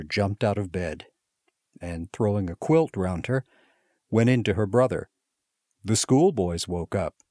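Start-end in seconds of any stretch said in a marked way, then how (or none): none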